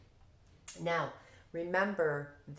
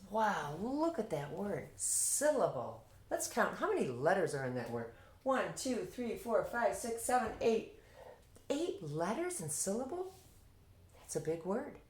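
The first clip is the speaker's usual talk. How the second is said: higher, wowed classroom voice; teacher to kids; 5 semitones higher than usual. Shown as a demonstration. Teacher counting letters with the kids, higher, amazed sing-song teaching voice. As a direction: marvel at the word for young students, pitch lifted. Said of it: "And then I'll say to the kids".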